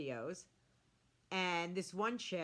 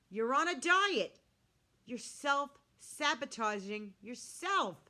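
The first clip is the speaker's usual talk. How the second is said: slow, emphatic, louder reproach; exasperated scolding; 4 semitones higher, 4 dB louder, slower than usual. Retold or imitated. Vlogger's thoughts to dieting woman, exasperated scolding. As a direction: spell it out as a frustrated telling-off.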